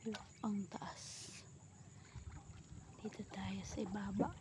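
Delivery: hushed whisper under the trees. Real, not acted. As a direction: drop to a quiet whisper.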